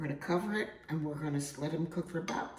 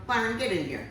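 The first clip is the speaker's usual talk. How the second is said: raised voice calling someone over; 7 semitones higher, 4 dB louder than usual. Real, not acted. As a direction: call loudly to the next room. Calling husband from next room.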